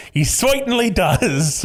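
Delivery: attempted Cockney accent that slips; a bad Cockney try. Shown as a demonstration. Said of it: "I was gonna try to do a, a Cockney accent".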